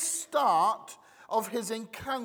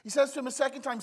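usual speaking voice

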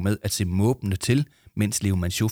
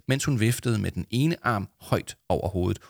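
usual speaking voice

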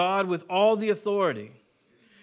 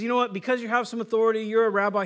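playfully flattering, a little higher and louder; butter him up with a grin in the voice; teasing mock praise; humoring him; 5 semitones higher, 5 dB louder, faster than usual. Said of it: "He's humoring him".

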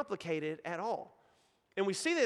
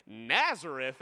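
lower-pitched, doubting question; incredulous exclamation; 7 semitones lower, slower than usual. A narrator's reading. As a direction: read it as a skeptic's exclamation. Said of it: "exclaimed Nathanael".

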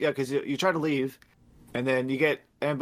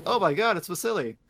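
higher, louder mock gasp of discovery; mock astonishment; 6 semitones higher, 3 dB louder than usual. Everybody reacting to Vasily's body popping out, mock astonishment. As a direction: act out a group's shocked recognition.

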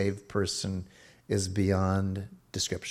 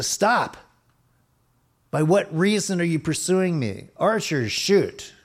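high, loud barked commands; mock angry commander; 8 semitones higher, 4 dB louder than usual. Shown as a demonstration. Hostile warrior confronting his pursuer, loud command. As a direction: yell the order to shoot. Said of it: "as opposed to".

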